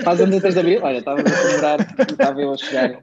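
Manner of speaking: talking through real laughter; amused laughter at the coincidence; laughing. Real, not acted. Birthday coincidence amuses him.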